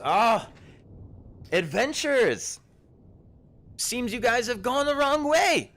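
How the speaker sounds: put-on high, loud, surprised old herbalist; a startled forager shooing travellers back; herb-picking woman. Herbalist warning the lost adventurers, high and loud. GM voices the gnome Ashleen.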